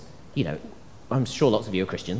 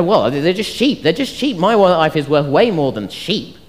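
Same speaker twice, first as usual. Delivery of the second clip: incredulous dismissal; 7 semitones higher, 6 dB louder than usual. Acted. Owner of a hundred sheep facing a wolf, loudly dismissive. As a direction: argue it away, pitch jumping up. Plays someone refusing to die for sheep.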